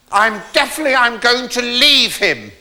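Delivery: high, slowed, emphatic comic outrage; mock distraught declaration. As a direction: declare it like a hammed-up wronged wife. Distraught wife announces leaving husband.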